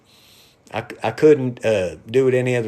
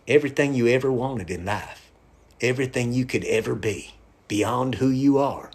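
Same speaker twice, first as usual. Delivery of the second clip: ordinary talk, nothing marked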